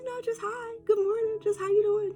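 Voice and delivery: high, soft sweet greeting; high soft cheerful greeting; sweet reply. Retold or imitated. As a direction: answer gently and brightly.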